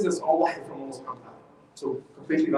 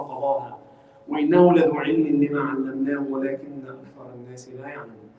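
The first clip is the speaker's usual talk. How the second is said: measured Arabic recitation, lower than the talk; Quran recitation; 3 semitones lower, much faster than usual. Acted. Teacher recites the verse to the class, reciting. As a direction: recite the verse in a low, even chant.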